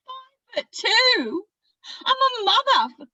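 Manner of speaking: high, loud, worked-up outburst; frantic Australian character, pitch climbing high; frantic protest. Acted. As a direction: build from insisting you're fine to indignant cries. Acted patient pleading to be let go.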